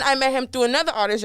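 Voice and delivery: ordinary talk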